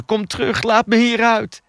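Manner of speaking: high-pitched shouted demand; calling out. A narrator's reading. Locked-in woman to her departing captor, calling out desperately. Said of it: "riep ze".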